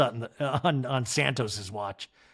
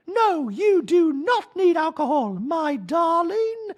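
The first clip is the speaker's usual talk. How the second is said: much higher, louder sing-song wife voice; a doting wife, pitched way up; Dorit impression; 6 dB louder, slower than usual. Wife nagging husband about salad, high mock voice. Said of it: "And Dorit's like".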